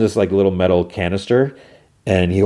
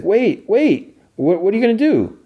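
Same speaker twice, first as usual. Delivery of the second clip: very high, hurried call; imitated alarmed plea; calling back; 14 semitones higher than usual. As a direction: stop someone leaving, pitched way up. Solo calling her back.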